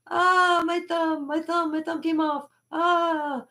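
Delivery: high-pitched fake agony; mock pained wailing. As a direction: wail as if pranking a child with a lost thumb. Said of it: "And he went like this".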